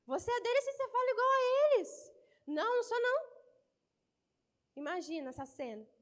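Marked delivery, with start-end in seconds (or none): 0.1-3.2 high, fast, insistent accusation; accusing servant girl. Acted. Preacher acting the servant girl accusing Peter. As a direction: press him hard, high and quick.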